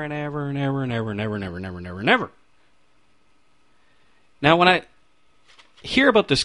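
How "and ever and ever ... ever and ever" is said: rattled off fast and quiet, mocking the endless ever; rushed mock repetition. Shown as a demonstration. Preacher mocking endless hell torment, fast and quiet. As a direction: race through the evers to mock forever.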